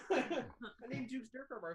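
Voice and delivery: laughing. Pretend. Parody of the Skywalker line.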